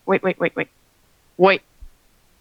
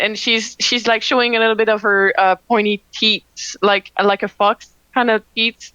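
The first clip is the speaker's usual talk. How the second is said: normal talking voice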